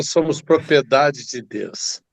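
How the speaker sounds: ordinary delivery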